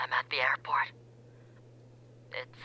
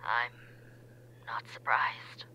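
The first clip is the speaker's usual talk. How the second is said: whispering; 5 semitones lower, 5 dB quieter than usual. Acted. Caller whispers.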